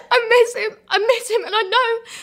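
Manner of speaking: high, tearful, barely holding it together; voice breaking, near tears. Acted. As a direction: pitch jumps high as grief spills over. Grief monologue breaks into tears.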